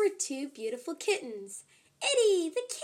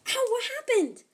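startled pain; 10 semitones higher, 5 dB louder than usual. Acted. Tail zapped by laser.

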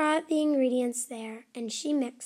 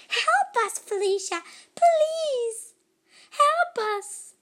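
much higher, louder pleading elf voice; high elf plea; 12 semitones higher, 5 dB louder, slower than usual. Acted. Elves beg Felicia to stop the noise, high squeaky plea. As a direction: squeal for help like tiny elves. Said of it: "flew around the world saying".

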